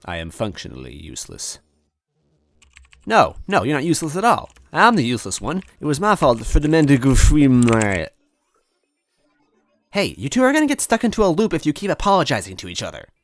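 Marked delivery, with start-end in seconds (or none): 3.1-8.1 higher and louder, rushing to reassure; upset protest. A narrator's reading. Luigi protesting, blaming himself. 9.9-13.1 high, louder, fed-up scolding; exasperated Toadette. A narrator's reading. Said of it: "Toadette groaned".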